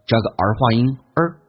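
usual speaking voice

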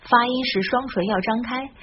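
usual speaking voice

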